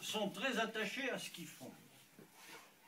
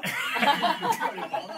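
mock exclaimed; 7 semitones higher, 9 dB louder than usual. Punchline of the hitchhiker joke.

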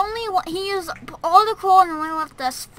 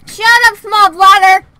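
playful yell; 7 semitones higher, 13 dB louder, slower than usual. Real, not acted. Yelling at someone off mic.

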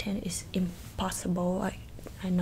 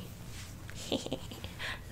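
soft laugh; 11 dB quieter than usual. Acted. Laughs at own insult.

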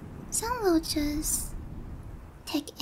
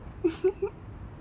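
soft little titter; scheming giggle; 4 dB quieter than usual. Acted. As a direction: giggle to yourself over the plan. Scheming to win him over.

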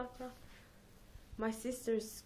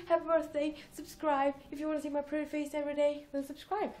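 higher, fast, playful swagger; hyper; 6 semitones higher than usual. Real, not acted. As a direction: hype them into subscribing. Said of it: "Oh, I'm so hyper".

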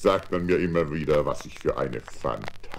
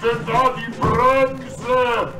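much higher, louder, far-off scream; panicked yell; 7 dB louder, slower than usual. Acted. Wolf rolling away on the piano, panicked screaming. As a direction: scream for the brake in terror.

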